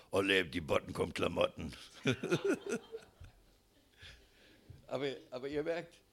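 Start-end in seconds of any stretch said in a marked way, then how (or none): 0.1-1.7 put-on blunt, louder old-couple version; mock weary crudeness; gruff coarse rhyme. Shown as a demonstration. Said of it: "dann heißt es nur noch". 1.8-3.0 high burst of laughter. Real, not acted. Laughs at own rhyme.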